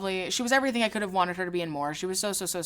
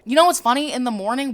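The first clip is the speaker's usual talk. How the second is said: ordinary talk, nothing marked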